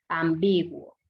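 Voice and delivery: low, measured "am-BI-guo"; stress on BI. Shown as a demonstration. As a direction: land on the middle syllable: am-BI-guo. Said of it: "¿Dónde lleva la fuerza de voz?".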